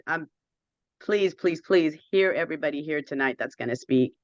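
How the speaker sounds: usual speaking voice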